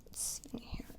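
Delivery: soft whispered aside; whispering. Real, not acted. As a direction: whisper it while checking. Adjuster muttering to himself.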